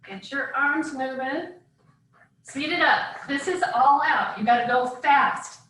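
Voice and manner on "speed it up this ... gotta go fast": ordinary talk, nothing marked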